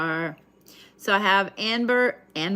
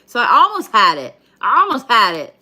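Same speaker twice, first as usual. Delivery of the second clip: loud; 4 semitones higher, 10 dB louder than usual. Real, not acted. Laughing at own mistake.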